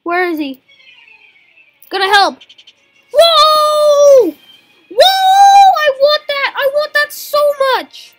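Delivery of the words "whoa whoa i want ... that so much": high, loud, drawn-out gasps of want; excited shouting. Real, not acted. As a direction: squeal with greedy delight. Gasping at a rare creature.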